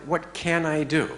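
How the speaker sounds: eager, ask for possibilities on "can"; open question. Shown as a demonstration. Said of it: "Same words, but totally different meaning".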